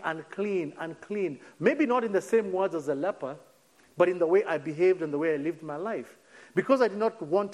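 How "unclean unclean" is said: normal talking voice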